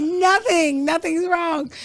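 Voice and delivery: high innocent squeak; high squeaky denial. Retold or imitated. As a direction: play dumb in a high voice. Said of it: "Did she do it in that voice?".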